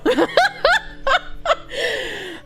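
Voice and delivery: long high laugh falling away. Real, not acted. Streamer laughing at chat comparison, laughing. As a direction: cackle at your own joke.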